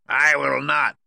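high, loud witch voice; witch's retort. A narrator's reading. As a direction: fling back a loud refusal as the witch. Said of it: "retorted the witch".

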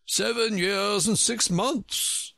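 Humpty Dumpty mulling over Alice's age, thoughtful; thoughtful repeat. A narrator's reading. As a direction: turn the words over slowly. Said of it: "repeated thoughtfully".

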